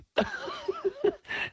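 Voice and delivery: high laugh. Real, not acted. Laughs at his own image.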